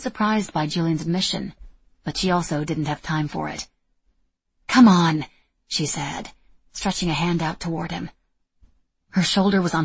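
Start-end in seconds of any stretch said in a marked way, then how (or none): 4.7-5.3 urging. Acted. Etta urging him, reaching.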